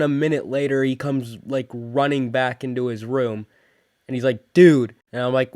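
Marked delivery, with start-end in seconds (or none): 4.5-4.9 loud, retold cry of alarm; urgent exclamation. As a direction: blurt it out like an urgent friend. Friend runs in alarmed, retold.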